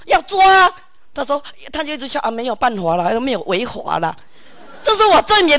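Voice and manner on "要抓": retold demand. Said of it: "我说你们当什么".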